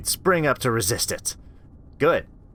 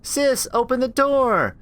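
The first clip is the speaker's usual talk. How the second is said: high, slow and sing-song; creepy coaxing child; 13 semitones higher, slower than usual. Acted. Creature coaxing Rachel to open up, creepy. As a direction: lure her out sweetly.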